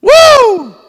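high loud celebratory whoop; joyful whoop. Real, not acted. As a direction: let out a happy holler.

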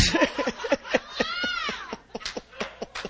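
high, airy burst of laughter; joyful high-pitched laugh. Real, not acted. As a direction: let a shrill peal of laughter out.

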